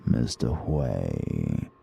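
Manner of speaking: ordinary delivery, nothing marked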